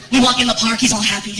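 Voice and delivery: normal talking voice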